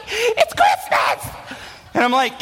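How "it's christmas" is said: put-on excited kid shout. Little brother yelling while bouncing on the bed, excited shout. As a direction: yell it like a hyper little brother.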